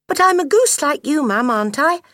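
put-on high, loud childlike voice; young gosling. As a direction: call out like an eager little gosling. Gosling protests to his mother.

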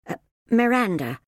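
put-on timid young girl; hesitant little girl. Shy girl voice.

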